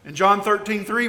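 ordinary delivery

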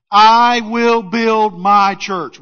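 slow, loud proclamation; proclaiming. Acted. Preacher booms Jesus's promise to his congregation, slow and loud. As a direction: declare it like a royal decree.

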